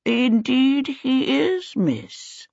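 put-on slow, high, doddering old man voice; old servant Sim. A narrator's reading. Sim answering Charmaine, slow and quavering. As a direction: creak it out like a frail old servant. Sim's voice in the story.

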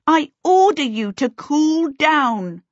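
high, slow, emphatic command; screamed order. A narrator's reading. Charmaine orders the steaming pipes to obey, screaming. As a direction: command it high, stretching each word. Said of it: "she screamed".

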